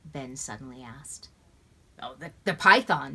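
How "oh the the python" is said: eager answer. A narrator's reading. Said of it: "Tom spoke without hesitation".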